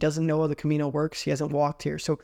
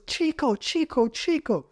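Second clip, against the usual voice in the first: high calling Spanish hostel owner; 10 semitones higher than usual. Retold or imitated. Hostel owner calls after Gabe, high frantic calling. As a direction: call him like the frantic owner.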